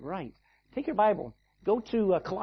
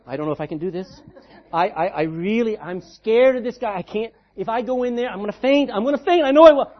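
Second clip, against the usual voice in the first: mock scared boxer; 2 semitones higher, 11 dB louder than usual. Preacher mocking a fearful boxer before a fight, hammed-up panic. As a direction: play the terrified fighter for laughs, rising to a near shout. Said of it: "You don't go in going".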